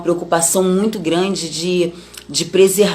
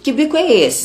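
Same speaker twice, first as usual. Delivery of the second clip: higher, louder teasing call-out of a pout; mother teasing her sulking daughters, higher playful scold; mock scolding mother; 8 semitones higher, 3 dB louder than usual. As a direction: poke fun at the sulky face. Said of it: "a pergunta que eu faria pras meninas".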